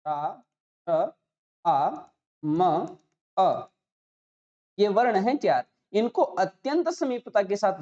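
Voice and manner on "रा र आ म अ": each sound of राम said apart: रा, र, आ, म, अ; spelled out sound by sound. Shown as a demonstration. Teacher splitting राम into its sounds for the class. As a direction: break राम into separate sounds with pauses. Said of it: "इस राम पद के अंदर चार वर्ण विद्यमान".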